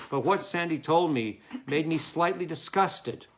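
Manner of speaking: usual speaking voice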